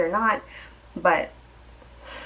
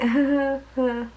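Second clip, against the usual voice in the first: imitating a forced, higher polite chuckle; fake polite laugh; 6 semitones higher than usual. At her date's bad jokes, a retold forced polite laugh. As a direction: laugh like the joke wasn't funny.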